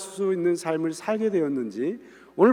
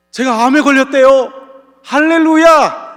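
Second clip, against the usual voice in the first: joyful shout of praise; 7 semitones higher, 11 dB louder than usual. Pretend. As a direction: cry out hallelujah as if bad news were good. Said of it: "오늘 식으로 따진다면".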